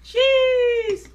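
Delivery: high, loud echo shout; shouting cheese. Pretend. Friend joins the cheese chant with a loud shout. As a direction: bellow the word back.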